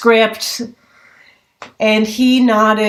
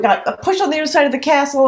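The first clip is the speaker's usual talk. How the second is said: ordinary talk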